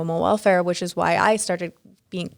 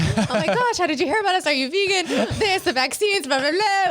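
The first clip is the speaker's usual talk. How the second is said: much higher, gushing nonstop chatter; babbling gush; 10 semitones higher than usual. Retold or imitated. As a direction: spill it all out starstruck. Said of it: "I was just, like, verbal diarrhea".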